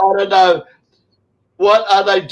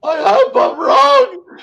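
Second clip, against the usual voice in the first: "wrong" shouted much higher, slow and drawn out; yelled; 12 semitones higher, slower than usual. Retold or imitated. Quoting his own video to the host, shouting. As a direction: yell it the way he did in the video. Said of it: "I said in the video".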